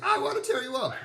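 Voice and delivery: high, mock-fierce dog voice; voicing an aggressive dog. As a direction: play the snarling dog for laughs. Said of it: "this dog is like".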